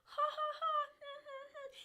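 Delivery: high-pitched sing-out jeering laughter; mock jeering laugh. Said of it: "everybody jeered at it".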